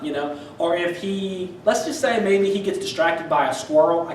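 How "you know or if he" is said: usual speaking voice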